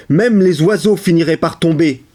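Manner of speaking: ordinary talk, nothing marked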